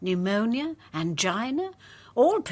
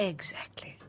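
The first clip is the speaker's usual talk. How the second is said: whisper; 8 dB quieter than usual. Acted. Conspiratorial agreement in secret.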